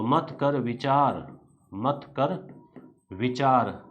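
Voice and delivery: slow, with a pause before विचार; rhyming mnemonic. Shown as a demonstration. Teacher giving students the rule four rhyme, slow and spaced. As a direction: lay the rhyme out slowly so it sticks. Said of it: "तो अब अपन ने बोला चार".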